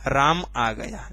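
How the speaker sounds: राम आ गया said slowly, low and neutral; normal full-stop statement. Shown as a demonstration. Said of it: "normal बोला आपने". (राम आ गया धीरे, सामान्य कथन की तरह)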